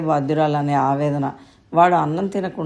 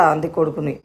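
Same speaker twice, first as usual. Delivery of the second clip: plain reading voice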